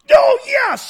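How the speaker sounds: high, loud burst of joy; excited shout. Retold or imitated. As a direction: shriek with sudden delight at the door. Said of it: "She opens it and goes".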